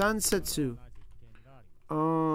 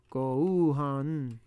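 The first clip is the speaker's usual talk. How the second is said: ordinary delivery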